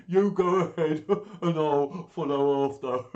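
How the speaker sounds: loud, guffawing monster voice; bursting with laughter. A narrator's reading. Gruffalo humouring the boastful mouse, laughing loudly. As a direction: laugh right through the words. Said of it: "bursting with laughter".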